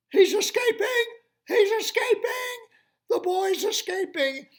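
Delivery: very high, loud alarmed yell; alarm shout. Acted.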